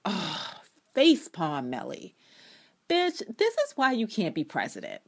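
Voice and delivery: mock-disgusted rant at Mellie; exasperated scoff. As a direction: scoff at her like an annoyed fan. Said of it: "and I'm just like".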